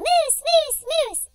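imitating mom calling out the dog's name; retold yelling. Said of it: "she's yelling".

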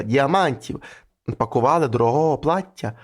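ordinary delivery, nothing marked

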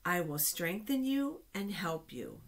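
usual speaking voice

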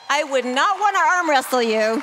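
high, loud teasing shout; loud playful exclaim. Real, not acted. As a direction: belt it out as a playful joke. Joking cheer to a bodybuilding graduate.

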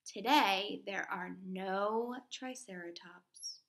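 put-on slow, clear read-aloud for young kids; reading to children. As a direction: read each fact slowly to the class. Reads nonfiction book to children.

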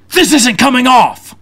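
high, slow, strained mock fury; pissed-off complaint. Speaker to prankster about stuck face paint, imitated furious complaint. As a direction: re-enact an outraged man stressing each word. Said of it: "and he is pissed".

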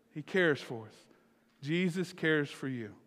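subdued, sincere statement with falling pitch. Real, not acted. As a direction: let it settle gently. Same words repeated more softly.

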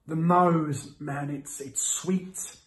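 ordinary delivery

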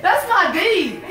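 excited. Retold or imitated. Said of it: "And I'm like".

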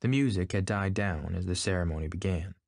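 plain reading voice

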